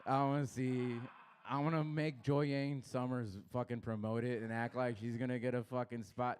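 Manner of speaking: ordinary talk, nothing marked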